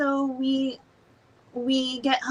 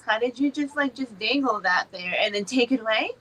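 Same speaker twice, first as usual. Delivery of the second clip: usual speaking voice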